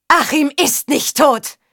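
high, drawn-out desperate cry; near-breaking denial. A narrator's reading. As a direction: cry out in anguished refusal. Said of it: "Ihre Stimme drohte sich zu überschlagen". (verzweifelter, hoher Aufschrei)

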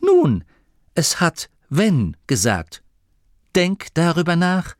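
high, pointed explanation with key words set apart; triumphant. Acted. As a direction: make your point victoriously. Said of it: "Grimma lächelte triumphierend". (triumphierend, betont)

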